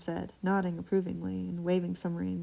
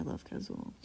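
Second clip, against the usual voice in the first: whisper; 5 semitones lower, 7 dB quieter than usual. Real, not acted. Fangirling over a character.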